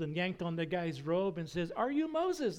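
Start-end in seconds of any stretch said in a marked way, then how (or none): 1.7-2.6 very high-pitched, insistent question; exasperated demand. Retold or imitated. Bush yanks on the guy's robe, exasperated high-pitched demand. Third ask, yanking the robe.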